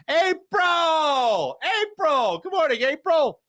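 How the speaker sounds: high, loud sing-song hello; bright drawn-out welcome call; sing-song greeting. Real, not acted. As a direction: call out the name like greeting a friend. Excited greeting to a chat viewer.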